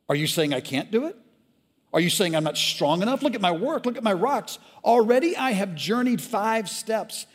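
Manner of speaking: high, loud, indignant ranting; outraged shout. Acted. Brother in the river shouting his sins at the firstborn. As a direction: hurl the guilt out furiously.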